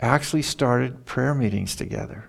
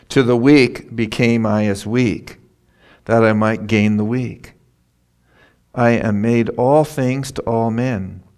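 loud, declaiming scripture reading; forceful scripture reading; 5 dB louder than usual. Acted. Preacher proclaiming Paul's words to the congregation, loud and forceful. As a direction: proclaim the verse to the whole room.

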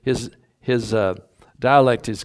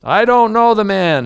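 much higher and louder angry shout; cursing denial; 10 semitones higher, 9 dB louder than usual. Acted. Peter swearing at those who stood by, furious shouted denial. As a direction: blast the denial like a cursing construction worker. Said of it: "he began to curse and swear".

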